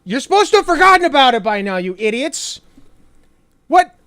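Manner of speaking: high, loud, fast exasperated rant; hammed-up fury at the idiots; mock angry Trump. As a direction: snap at the public in frustration. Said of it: "he's like".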